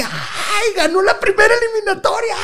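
imitating excited fans, very high-pitched squeal; mock a screaming crowd, voice shot way up; penggemar heboh. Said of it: "y mucha raza que".

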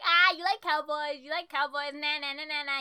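loud, high playground teasing; mocking schoolyard chant; childish taunting. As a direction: imitate a kid jeering in singsong. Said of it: "that childhood shit".

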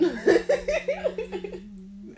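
big burst of laughter; loud laugh. Real, not acted. Group chant turns to laughter.

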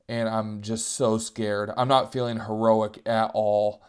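ordinary talk, nothing marked

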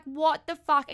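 retold shock. Reacting to a sudden peck, mock shocked outburst. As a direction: act out being stunned. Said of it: "I was in so much shock".

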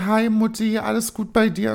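high, put-on cheerful greeting; gespielt fröhlich. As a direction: act breezy for your mother. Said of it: "Schauspielerin, die ich bin". (gespielt fröhlich, mit hoher Stimme)

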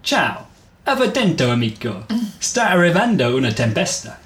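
acted Italian character line; Italian train conductor. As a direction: call out the Italian like a local railwayman. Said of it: "Byron's Italian had progressed little".

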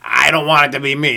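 ordinary delivery

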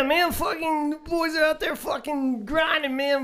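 high, hammed-up Southern accent; terrible Southern accent. Co-host cheering the football season in a terrible Southern accent. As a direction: put on a bad Southern good-old-boy accent. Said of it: "That's a terrible Southern accent".

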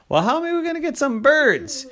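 high, quick questioning voice; doubting child. Acted. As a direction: fire back a puzzled question. Kid questions the bird plan.

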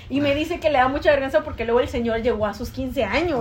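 ordinary talk, nothing marked

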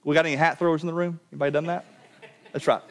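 usual speaking voice

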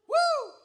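high, loud joyful whoop; excited whoop. Real, not acted. Preacher whooping with joy to the crowd, high and loud.

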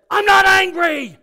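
high, loud shouted denial; angry denial. Retold or imitated. Coworker shouting back that he's not angry. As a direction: yell it, insisting you're not angry. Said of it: "And he said".

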